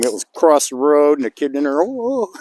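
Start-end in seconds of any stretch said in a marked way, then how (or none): 1.8-2.3 put-on surprised kid. Kid amazed in car.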